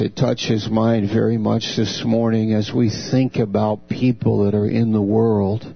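ordinary talk, nothing marked

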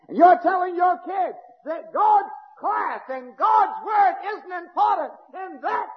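fiery preaching. Real, not acted. Preacher denounces lateness as sin.

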